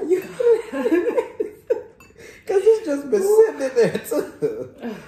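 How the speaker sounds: high laughing through words; laughing hard while talking. Real, not acted. Laughing at the pepper.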